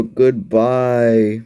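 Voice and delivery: very slow, stretched goodbye; long, lingering goodbye; drawn-out farewell. Real, not acted. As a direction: stretch out the sign-off word by word. Drawn-out sign-off.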